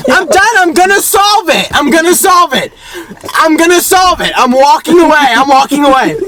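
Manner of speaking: high-pitched, frantic repeating; hammed-up squeal of someone at breaking point; going crazy. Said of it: "he went that crazy in this situation".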